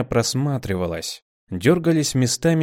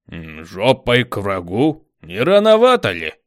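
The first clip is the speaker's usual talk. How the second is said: doubtful; 5 dB louder than usual. A narrator's reading. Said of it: "обозначил сомнение Шест".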